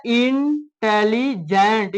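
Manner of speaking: "इंटेलिजेंट" slowly in three separate chunks, in-telli-gent; split into syllables. Shown as a demonstration. Teacher counting syllables for students, "इंटेलिजेंट" slowly split in three. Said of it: "ये तीन syllable के हो गए".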